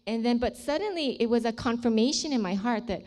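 ordinary talk, nothing marked

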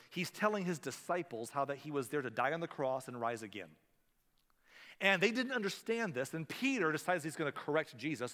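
ordinary talk

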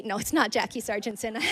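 ordinary delivery

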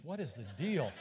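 ordinary delivery

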